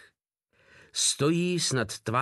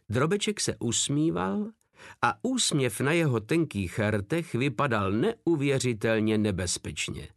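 plain reading voice

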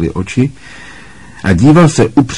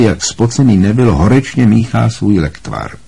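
usual speaking voice

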